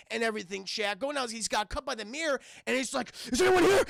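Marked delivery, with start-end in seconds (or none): 3.3-3.8 high, loud shouted question; acted shouting. Retelling an intruder holding a kid, acted shout. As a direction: call out urgently at full voice. Acting out the attack in the movie.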